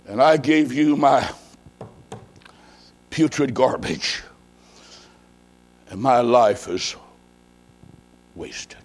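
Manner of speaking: low, halting confession fading away; ashamed remorse. Acted. Charlie kneeling at Christ's feet giving account, low remorse. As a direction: confess brokenly, long pauses, down to nearly nothing. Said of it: "in shame, embarrassment, regret, and remorse".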